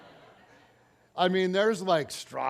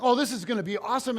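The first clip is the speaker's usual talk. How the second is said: louder, higher, gushing; excited kid; 5 semitones higher, 6 dB louder, faster than usual. Retold or imitated. Kids cheering for the coach's plan, loud and high. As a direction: hype it up like an eager kid.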